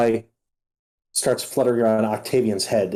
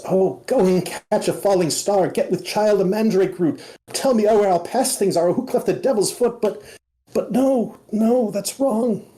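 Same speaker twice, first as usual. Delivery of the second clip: high frantic verse; 9 semitones higher than usual. Acted. Butterfly reciting then breaking off.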